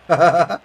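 a loud rising laugh. Real, not acted. Streamer laughing at a chat comment.

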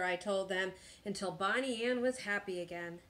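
ordinary delivery

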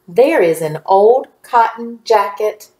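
slowish, words joined into a phrase. Shown as a demonstration. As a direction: read it along smoothly at a measured pace. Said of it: "Read it with me one more time".

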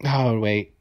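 ordinary talk

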